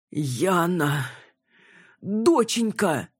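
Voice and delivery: drawn-out, rising cry to a daughter; fatherly exclamation. A narrator's reading. Father greets his daughter after danger, exclaiming. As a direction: call out to your child, stretching the word high. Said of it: "воскликнул Иван Демидович".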